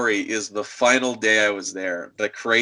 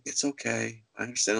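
much softer, subdued reply; quiet; 9 dB quieter than usual. Retold or imitated. Customer accepting the clerk's apology, quiet. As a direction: say it small and resigned, imitating him. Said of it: "he's quiet".

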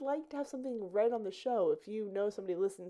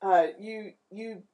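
louder scene-setting narrator voice; game master narration; 3 semitones lower, 6 dB louder than usual. Acted. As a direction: set the scene for the party.

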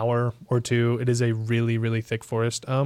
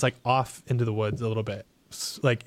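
ordinary talk

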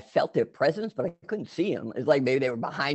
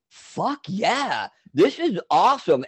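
high-pitched swooping thrill; retold elation; 6 semitones higher, slower than usual. Tripper retells wave after wave, elated. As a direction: relive the rush of joy.

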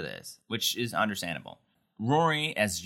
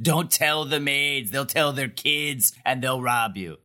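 slow, higher-pitched mock warning; hammed-up, drawn-out cautioning; imitating a paranoid warning; 4 semitones higher, slower than usual. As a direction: draw out the warning as a caricature. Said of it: "She also, like".

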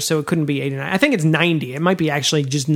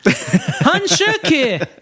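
laughing; 10 semitones higher, much slower than usual. Real, not acted. Laughing wordplay.

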